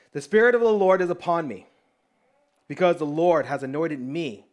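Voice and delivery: measured, loud scripture read. Acted. Preacher reading Isaiah to the congregation, proclaiming. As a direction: proclaim the verse from the pulpit.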